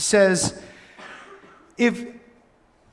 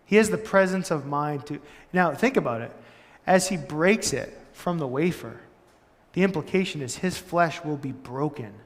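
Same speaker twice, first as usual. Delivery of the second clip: usual speaking voice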